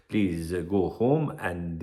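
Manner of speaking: a hint of a gentle foreign dentist, drawn out; polite Spanish dentist. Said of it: "he didn't speak like that".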